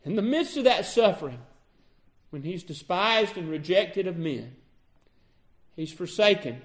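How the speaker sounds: ordinary talk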